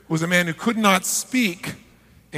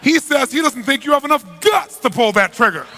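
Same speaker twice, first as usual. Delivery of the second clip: punchline delivery; 7 semitones higher, 6 dB louder than usual. Retold or imitated. Punchline, interpreter's betrayal.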